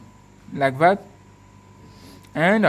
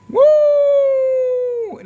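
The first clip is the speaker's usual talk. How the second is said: a high, loud cheer of delight; excited whoop; 5 dB louder than usual. Real, not acted. Showing the phone camera to viewers, a delighted high whoop. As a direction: whoop like the trick just worked.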